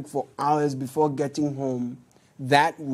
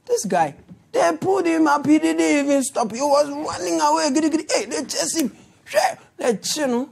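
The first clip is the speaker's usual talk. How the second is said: excited, high storytelling; 10 semitones higher than usual. Real, not acted. Excited retelling of chase.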